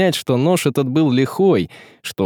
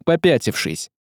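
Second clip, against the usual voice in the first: usual speaking voice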